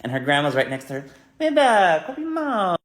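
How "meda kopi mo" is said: much higher, loud grandma call. Retold or imitated. Grandma scolding the coughing child.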